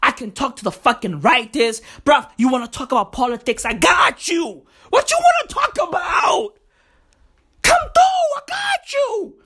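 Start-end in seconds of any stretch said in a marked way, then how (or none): 4.9-9.3 high sing-song call. Acted. Sing-song refrain of his rant.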